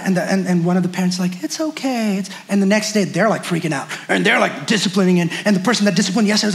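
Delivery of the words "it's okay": soft, calming parent voice; gently reassure, as the calm parent; hushed mock reassurance; acted soothing. Calm parent soothing after outburst.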